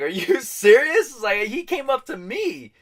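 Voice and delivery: high, drawn-out indignant cry; outraged protest. Retold or imitated. Protesting to the bouncer who threw him out, outraged cry. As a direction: protest loudly, voice shooting up.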